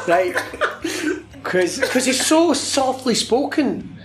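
high-pitched, amused and laughing through the words; barely holding back the giggles; talking through laughter. Real, not acted. Laughing over own bad impression.

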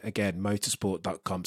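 ordinary delivery

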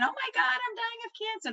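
mock the terrified shriek you let out at work; panicked scream. Nurse at work hears she has cancer, high panicked retold cry. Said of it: "So I screamed".

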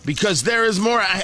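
ordinary talk

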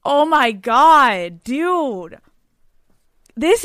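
slow, loud, drawn-out disbelief; stunned. Real, not acted. Host reacting to a listener's letter, stunned. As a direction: stretch it out, floored.

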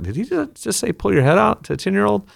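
high, disbelieving parent in the crowd; mock outrage from the stands; shocked onlooker. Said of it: "half the crowd was like".

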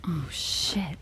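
breathless whispered curse; shocked whisper. Acted. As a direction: whisper it under your breath, stunned. Sees ruined hallway, afraid.

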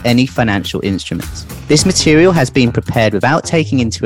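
quick rattled-off disclaimer read; fast legal disclaimer. Acted. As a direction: rattle off the legal small print. Disclaimer read over music.

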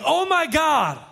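high-pitched mock panic; imitated loud cry. Preacher imitates woman on plummeting plane, high loud cry. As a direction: mimic a passenger crying out in terror. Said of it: "in a very loud voice saying".

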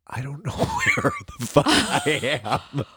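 high wail dissolving into laughter; laughing bewilderment. Retold or imitated. Storyteller recalling walking out lost, laughing wail. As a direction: crack up while acting out being lost.

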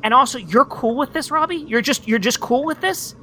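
high, rushed disbelief; incredulous. Real, not acted. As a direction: pile on the disbelief. Incredulous at roommate.

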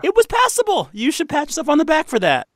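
squeaky high teasing tone. Real, not acted. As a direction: tease in a very high voice.

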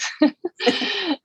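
short high amused laugh; light laugh. Real, not acted. Guest laughing at her own accent, light laugh.

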